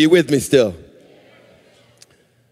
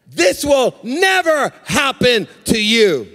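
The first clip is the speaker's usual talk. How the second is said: slow, weighty "This will never happen to you"; emphatic refusal; 4 semitones higher, slower than usual. Acted. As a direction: lean hard on "never", word by word. Repeats Peter's exclamation slowly, emphatically.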